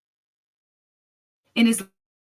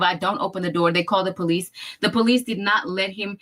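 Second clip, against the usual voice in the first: normal talking voice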